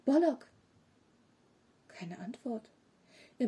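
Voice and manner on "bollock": raised, louder call of a name. A narrator's reading. Boy to his vanished monster friend, calling out. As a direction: call out for someone missing. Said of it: "Tom rief".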